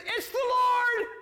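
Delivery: high, loud, drawn-out shout; excited cry. Acted. John recognizing Jesus from the boat, crying out excitedly.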